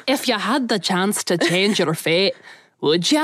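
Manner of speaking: slow Scottish Merida line; lightly done Scottish brogue; Scottish accent. Retold or imitated. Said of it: "you're more inclined to Scottish and Irish".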